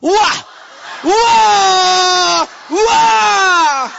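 high, loud, drawn-out wah; Japanese exclamation. Retold or imitated. Preacher imitating a Japanese speaker's amazed wah. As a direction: shout it like a samurai. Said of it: "How about Japanese".

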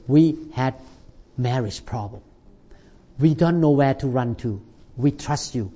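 soft, low voice of the troubled wife; quiet retold wife. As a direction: quietly relay her plea. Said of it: "the wife called us up and say".

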